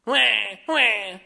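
loud, high mock baby crying; mock baby wail. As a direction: wail like a spoiled toddler. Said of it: "We're like a little baby".

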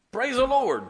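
delighted Praise the Lord; joyful praise. Retold or imitated. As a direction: cry it out in happy surprise. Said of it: "I went".

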